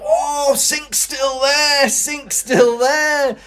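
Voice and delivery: very high, slow delighted cry; drunken wonder. Retold or imitated. Drunk ex-tenant thrilled at the sink, high and slow. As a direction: marvel like a drunk rediscovering home. Drunk marvelling at old room.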